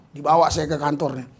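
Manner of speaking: normal talking voice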